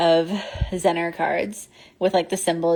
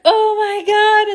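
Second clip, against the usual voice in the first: slow, much higher and louder stunned cry; shocked gasp; 6 dB louder, slower than usual. Retold or imitated. Group freaking out at the playback, shocked cry. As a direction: draw it out in disbelief.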